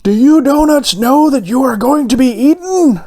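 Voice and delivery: high, sing-out call to a group; yelled question. A narrator's reading. Baker checks with the other donuts, high-pitched calling yell. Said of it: "The baker yelled to the other donuts".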